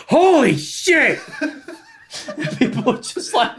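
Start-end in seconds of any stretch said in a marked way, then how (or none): 0.1-1.2 high, drawn-out mock yell; imitated outburst. Friend imitating a man startling a crowd, mock yell. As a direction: holler it slowly, half laughing. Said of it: "He goes".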